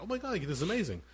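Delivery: high, starstruck burst of awe; wide-eyed movie-theater amazement; excited gush. Retold or imitated. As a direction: gush like a kid seeing it for the first time. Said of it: "I was like".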